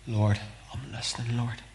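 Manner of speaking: soft, listening prayer; hushed prayer. Shown as a demonstration. Preacher showing how to listen for God, hushed.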